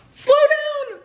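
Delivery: high, loud warning; urgent shout. Retold or imitated. Staff warning the mother as the baby comes, urgent shout. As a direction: call it out sharply.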